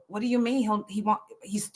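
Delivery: usual speaking voice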